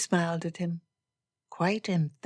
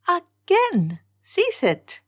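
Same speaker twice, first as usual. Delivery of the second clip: high, loud, exasperated protest; exasperated outcry; 12 semitones higher, 7 dB louder, much slower than usual. Acted. Elizabeth orders Darcy to stop, high exasperated outcry. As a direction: cry out sharply to make him stop.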